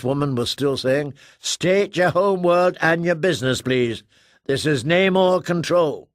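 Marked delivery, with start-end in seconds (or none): 1.4-6.1 higher, louder, insistent demand; officious control officer. Acted. Namor Control demanding name and business, insistent official. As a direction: repeat the challenge like a wary controller.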